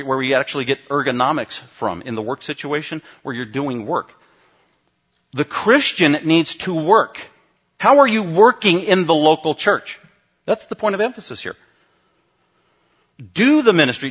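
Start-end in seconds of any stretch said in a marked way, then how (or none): 5.3-14.1 forceful preaching. Acted. Pastor urging his church to work, loud forceful preaching. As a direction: preach it hard and loud to the congregation. Pastor drives home the point.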